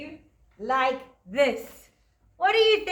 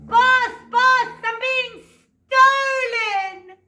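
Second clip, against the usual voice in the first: high, loud cry for help from the harp; harp shouting; 10 semitones higher, 6 dB louder, much slower than usual. Acted. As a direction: call out to the boss in panic, much higher and louder. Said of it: "the harp started shouting".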